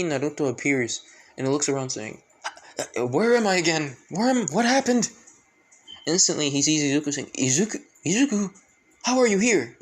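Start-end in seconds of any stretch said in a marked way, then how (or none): 2.8-5.1 confused. Acted. Naruto waking up lost, alarmed high questions. 7.4-9.8 high, excited calling of the name; can't believe who he sees; surprised. Acted. Naruto spotting Izuku, high surprised calling out.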